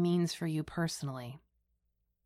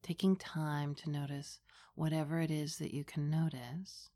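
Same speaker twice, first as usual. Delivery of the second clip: hushed and unhurried, close to the ear; right in your ear; 2 semitones lower, 5 dB quieter than usual. Acted. Leads a guided meditation.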